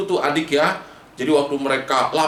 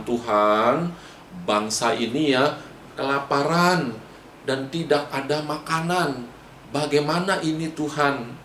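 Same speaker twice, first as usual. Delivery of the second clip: low, subdued plea to God; Moses reporting to God; 4 semitones lower, 4 dB quieter than usual. Acted. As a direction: report humbly in a lowered voice. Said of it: "Musa lapor sama Tuhan".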